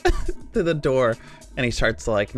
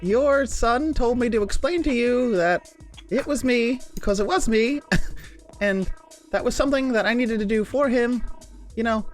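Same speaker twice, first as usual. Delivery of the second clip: much higher pitched hero voice; high character voice; 11 semitones higher than usual. Acted. Kalo explaining to the keeper.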